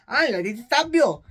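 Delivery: loud, high mock threat; minaccia recitata. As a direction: play-act a knife-wielding threat. Knife threat acted from plot.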